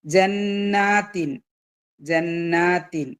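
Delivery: ജന്നാത്തിൻ very slowly, humming the double n; held nasal n. Shown as a demonstration. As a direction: hold ന്ന and the long aa in ജന്നാത്തിൻ. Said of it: "രണ്ട് count മണിച്ചിട്ട്".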